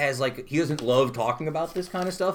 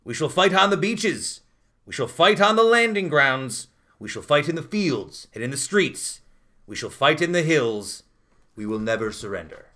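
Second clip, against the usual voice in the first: dramatic reading; 4 dB louder than usual. Shown as a demonstration. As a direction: declaim it like a wartime leader. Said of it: "I'll give you a dramatic reading".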